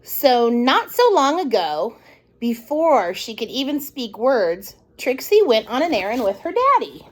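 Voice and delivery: ordinary delivery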